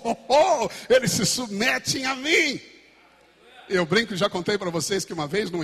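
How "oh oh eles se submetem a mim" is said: higher, louder, slow gloating chant; mock triumphant boast. Pretend new exorcist boasts of the demons obeying. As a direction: crow it like a giddy beginner.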